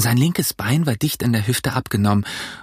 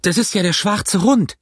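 raised, urgent shout; high-pitched alarm call to stop someone; crying out; 8 semitones higher than usual. A narrator's reading. Boy raises the alarm in the tavern, crying out. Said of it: "rief ich".

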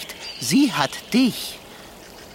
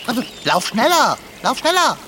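higher and louder, hurried panicked pleading; panicked urging; 4 semitones higher, 4 dB louder, faster than usual. Acted. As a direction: hurry her across in fear. Cat panics on the bridge.

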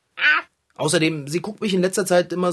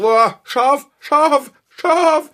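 rising, louder yelps at the sting; burning yelp; 11 semitones higher, 7 dB louder, much slower than usual. Acted. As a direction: yelp higher on each scharf. Toothpaste burns his mouth.

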